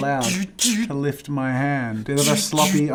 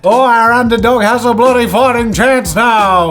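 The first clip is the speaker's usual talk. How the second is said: sports commentator; 6 semitones higher, 8 dB louder than usual. Pretend.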